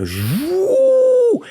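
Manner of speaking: a sudden high falsetto whoop, like a light bulb popping on; high falsetto woo. Retold or imitated. Recalling the burning bush moment, a high falsetto woo.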